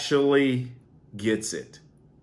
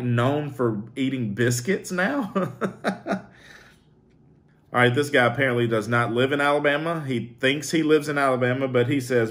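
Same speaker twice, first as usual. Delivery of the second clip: normal talking voice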